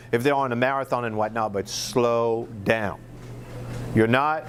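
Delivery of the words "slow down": deliberately slow. Shown as a demonstration. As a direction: model the slow pace. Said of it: "Most people are trying to go too fast".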